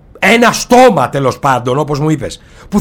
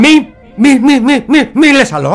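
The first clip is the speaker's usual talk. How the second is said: louder, pleading cut-off with a laugh; exasperated pleading; 5 semitones higher, 4 dB louder than usual. Acted. Cutting off the creature's tirade, exasperated. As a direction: stop him, half laughing.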